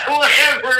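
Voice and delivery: high, laughing whatever; laughing echo. Retold or imitated. Friend repeating the judge's brush-off, laughing. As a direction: toss it back with a laugh. Echoing the judge's dismissive line.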